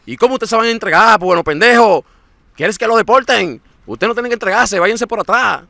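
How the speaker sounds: loud, high-pitched scolding in a mock Mexican voice; acted loud telling-off; imitated Mexicans. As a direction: berate them like an exasperated Mexican smuggler. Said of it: "dicen los mexicanos".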